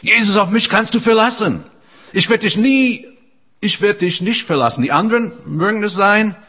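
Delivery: loud, high cocky pledge of loyalty; boastful Peter. Retold or imitated. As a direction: play the big-mouthed Peter swearing loyalty. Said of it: "War Petrus war so einer".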